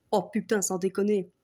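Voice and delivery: ordinary talk, nothing marked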